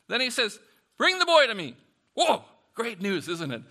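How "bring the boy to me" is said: higher and louder, firm call; loud command. Acted. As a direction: call for the boy with authority. Jesus commanding.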